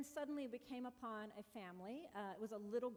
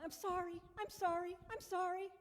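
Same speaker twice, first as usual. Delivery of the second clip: high, louder string of sorries; repeated apologies; 8 semitones higher, 7 dB louder than usual. Retold or imitated. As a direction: keep apologizing while riding away.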